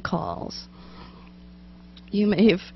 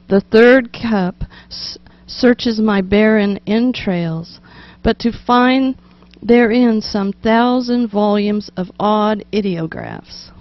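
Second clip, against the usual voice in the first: slow, louder, drawn-out third cup; poem reading; 5 dB louder than usual. Acted. Host rereading the tea poem line properly, slow and grand. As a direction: stretch out "searches my barren entrails". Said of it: "let me give that to you again".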